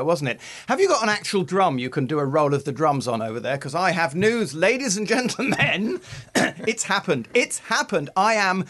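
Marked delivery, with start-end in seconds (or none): 3.6-8.6 rising higher, stretching out the final words; excited big announcement. Real, not acted. Host announces his coffee machine news to co-host, excited fanfare. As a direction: build up like a drum-rolled reveal. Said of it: "running out of breath here with excitement".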